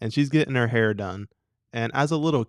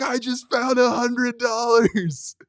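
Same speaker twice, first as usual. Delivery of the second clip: high, slow, loud announcement; excited kid shout; 12 semitones higher, 6 dB louder, slower than usual. Retold or imitated. Kid calling out his find across the park.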